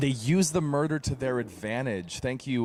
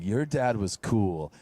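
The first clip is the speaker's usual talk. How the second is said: mock taunt; 3 semitones lower, much slower than usual. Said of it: "insulting him and saying".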